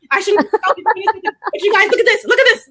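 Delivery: hyped-up scope host rising to a squeal; mock excited. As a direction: pile on the excitement, laughing through it. Imitating, building excitement.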